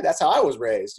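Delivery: ordinary talk, nothing marked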